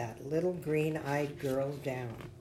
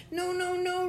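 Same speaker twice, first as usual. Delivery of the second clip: very high, tiny character voice; little bubble protesting. A narrator's reading. Bubble refuses in story.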